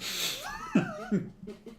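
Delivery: squealing laugh; high-pitched laugh. Acted. Ghoul laughs.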